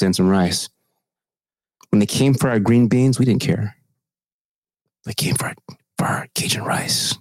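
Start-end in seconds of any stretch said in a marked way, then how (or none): 5.1-7.2 hushed, breathless whisper of doom; dramatic whisper. Pretend. To his co-host about losing Cajun rice, a mock-solemn whisper. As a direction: whisper it like a grave conspiracy.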